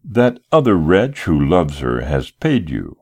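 usual speaking voice

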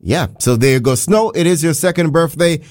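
ordinary talk, nothing marked

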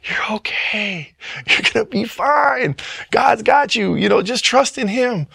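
high, tearful, comforting; reassuring voice. Retold or imitated. A sermon's reassurance echoing as he breaks down, high and tearful. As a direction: soothe as if through tears. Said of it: "It's like".

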